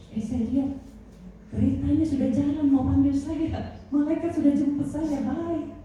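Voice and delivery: put-on high, airy voice of a dying old pastor; old pastor quoted. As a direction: lift the voice high as the old man sees the chariot.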